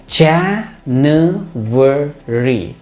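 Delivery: January very slowly in four drawn-out beats; syllable by syllable. Shown as a demonstration. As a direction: stretch January out as ja-nu-a-ry, beat by beat. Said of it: "चार syllable वाले word".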